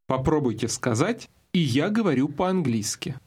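normal talking voice

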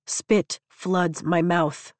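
ordinary delivery, nothing marked

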